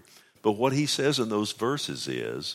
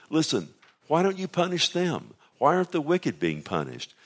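Job's outcry, higher; indignant protest; 7 semitones higher than usual. Retold or imitated. Preacher voicing Job demanding the wicked be punished, indignant. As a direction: pitch the question up high in protest.

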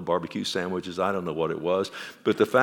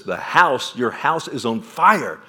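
louder, slower alarm call; urgent warning; 2 semitones higher, 5 dB louder, slower than usual. Acted. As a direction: raise the alarm at the door, slower. Neighbor warns of burning house.

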